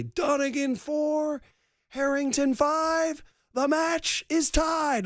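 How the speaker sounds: high, slow, drawn-out announcer call; golf match announcer. Retold or imitated. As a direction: call the score like the PA announcer at the 18th. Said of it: "you hear the guy say".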